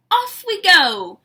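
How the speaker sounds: loud, slow call. A narrator's reading. Bird calls out as they set off, loud. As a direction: sing it out to set off. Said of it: "calls Bird".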